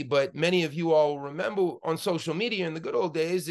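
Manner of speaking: usual speaking voice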